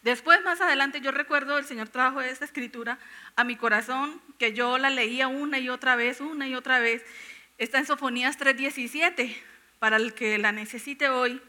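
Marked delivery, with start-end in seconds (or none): none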